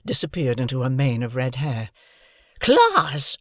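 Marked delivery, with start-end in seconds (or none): 2.6-3.4 high, loud sharp scream; screaming name. Acted. Missus Hamilton screaming at Hoggy, outraged shriek. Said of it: "she screamed".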